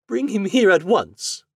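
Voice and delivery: high, loud commanding order; imperious lady. Acted. Heiress commanding servants, high and imperious. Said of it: "she cried".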